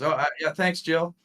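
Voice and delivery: a hint of a chatty guide; casual thanks. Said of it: "And Jill goes".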